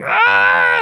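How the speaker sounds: high strained shout; high yelp of effort. Retold or imitated. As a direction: cry out pushing the bar. Straining on rep.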